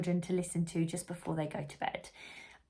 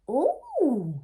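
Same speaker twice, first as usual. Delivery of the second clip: high, loud surprised oh; little bees' surprise; 7 semitones higher, 6 dB louder than usual. Acted. Little bees surprised by the news, high oh. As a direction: gasp it out as a class of small bees. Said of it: "said the little bees".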